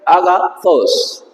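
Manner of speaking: "agathos" drawn out slowly, accent on the last syllable; teacher reading a word with grave accent slowly. Shown as a demonstration. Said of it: "Aquí dice".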